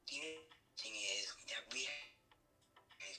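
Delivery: whispering. Real, not acted. Prank caller whispers again.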